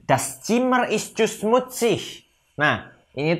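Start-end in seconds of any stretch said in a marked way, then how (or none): 0.1-2.3 slow, higher, carefully spelled-out German; slow teacher model. Shown as a demonstration. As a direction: say each word slowly for students to copy. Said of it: "teacher models German sentence". (kalimat contoh diucapkan pelan dan jelas)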